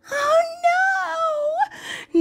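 put-on high, young girl's cry of dismay; girl's high wail of alarm; upset little girl crying out. A narrator's reading. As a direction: cry out like a frightened child. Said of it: "Naomi called out".

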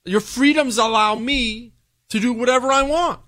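high, lightly voiced taunting spy; spy mocking American freedoms, lightly voiced and high. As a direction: sneer at the freedoms, a mild mock voice. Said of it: "He was mocking them".

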